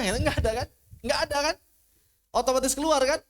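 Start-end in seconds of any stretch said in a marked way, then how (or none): none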